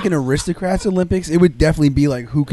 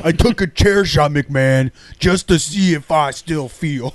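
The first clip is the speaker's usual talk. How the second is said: [name] voice; slower than usual. Pretend. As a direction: drawl it slowly like [name]. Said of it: "[name] bit".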